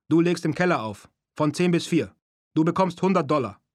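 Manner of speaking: put-on loud, high-pitched Japanese-accented voice; punchy phone message in a Japanese accent; broad Japanese accent. A narrator's reading. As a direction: play a caller with a thick Japanese accent. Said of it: "mit einem breiten japanischen Akzent".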